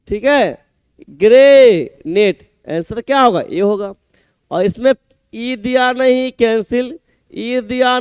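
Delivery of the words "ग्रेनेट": ग्रेनेट slow, with a long first syllable; broken into syllables. Shown as a demonstration. Teacher matching spelling to sound, ग्रेनेट slowly broken up. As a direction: stretch grey, then set नेट apart. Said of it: "तोड़, तोड़ का ऐसे याद रखेंगे".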